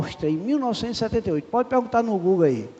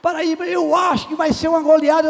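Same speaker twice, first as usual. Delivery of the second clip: excited shout; 9 semitones higher than usual. Retold or imitated. Hospital director hugging the preacher during Brazil's match, shouting excitedly. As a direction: bellow the name in football joy. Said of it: "começou a gritar".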